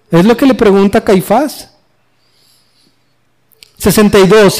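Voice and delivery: ordinary delivery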